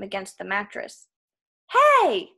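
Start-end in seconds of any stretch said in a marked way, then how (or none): 1.7-2.3 very high, loud protest; startled yell. A narrator's reading. Krusty caught by his own ropes, yelling. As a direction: yell out as you are grabbed. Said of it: "he yelled".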